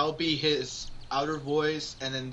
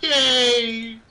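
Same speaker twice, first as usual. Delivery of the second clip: a much higher, louder joyful yay; excited cheer; 11 semitones higher, 9 dB louder than usual. Real, not acted. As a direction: cheer out loud in delight. Cheering friend's phone idea.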